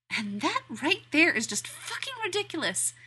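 high, disbelieving inner exclamation; incredulous thought. Acted. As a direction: marvel at it, half laughing. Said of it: "Danny thinks".

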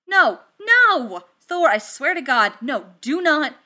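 loud, high, urgent protest; alarmed shout. Acted. Tony stopping Thor from wrestling the bot, alarmed shout.

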